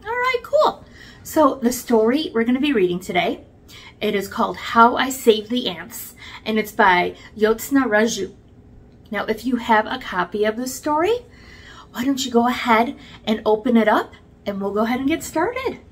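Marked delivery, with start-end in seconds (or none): none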